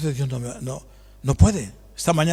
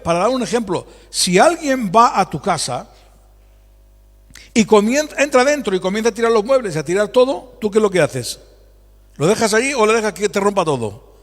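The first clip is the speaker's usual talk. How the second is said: ordinary talk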